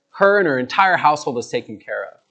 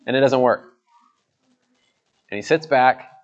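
usual speaking voice

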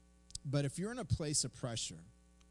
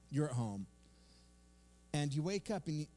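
usual speaking voice

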